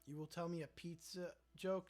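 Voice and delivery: usual speaking voice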